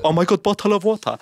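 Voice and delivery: high mock-swooning "Oh my God, bottle of water"; gushing fan. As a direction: swoon at the British accent like a smitten listener.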